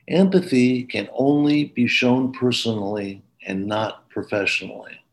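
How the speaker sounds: ordinary talk, nothing marked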